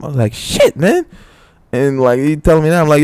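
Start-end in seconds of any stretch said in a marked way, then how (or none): 0.3-1.0 stunned. Retold or imitated. Reaction to fight news.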